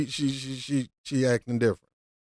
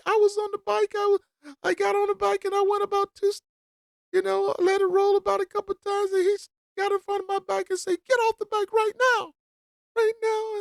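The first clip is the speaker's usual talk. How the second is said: much higher, whiny mock Karen telling police; mocking Karen's story. Commentator imagines the woman lying to police, high whiny parody. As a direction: play the wronged woman pleading to police. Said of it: "they still would side with her".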